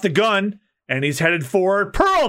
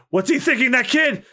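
plain reading voice

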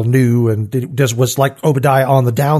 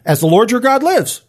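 higher-pitched, quicker challenge; challenging; 8 semitones higher than usual. Acted. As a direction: throw it out as a pointed dare. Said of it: "it is a bit of a challenge".

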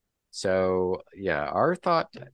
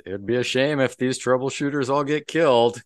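mock regret, higher and louder; ironic; 7 semitones higher, 8 dB louder, faster than usual. Real, not acted. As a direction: tease the grim fate. Ironic foreshadowing remark.

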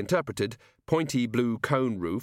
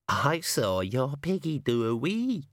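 slow, higher, smug little voice; gloating bobble hat; 4 semitones higher, slower than usual. A narrator's reading. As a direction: savour the tiny scandal. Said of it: "implicit in the tone".

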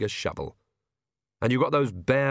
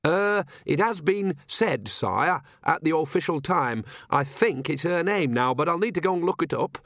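higher, hesitant official answering his master; Sean, hesitant historian; 5 semitones higher than usual. Acted. Royal historian answering the king, higher and hesitant. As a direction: start unsure, then explain dutifully.

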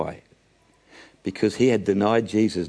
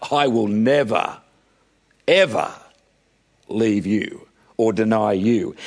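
slow, emphatic vow broken by pauses; defiant. Acted. Peter vowing never to deny Jesus, slow and defiant. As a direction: drive each word home with mock-solemn defiance. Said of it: "who defiantly told Jesus".

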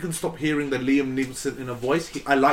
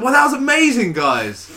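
high, loud faked enthusiasm; mock excitement; 9 semitones higher, 7 dB louder, slower than usual. Faking excitement to trick listeners, high and loud. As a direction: oversell it as if you'd listened. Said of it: "I'm just like".

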